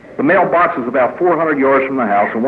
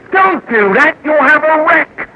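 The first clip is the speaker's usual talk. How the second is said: high-pitched comic warning; mock alarm; 13 semitones higher, 4 dB louder than usual. Speaker warning someone handing God the wheel, mock alarm. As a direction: cry out high as if stopping a crash.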